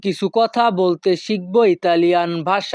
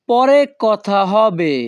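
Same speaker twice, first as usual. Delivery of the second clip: slow model phrase; slower than usual. Shown as a demonstration. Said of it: "পরবর্তী বাক্যটি আছে".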